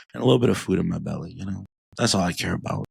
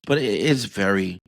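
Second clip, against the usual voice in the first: usual speaking voice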